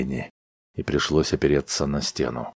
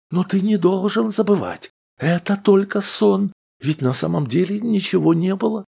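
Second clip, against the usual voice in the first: wife voice; 3 dB louder than usual. A narrator's reading. Wife argues, held higher voice.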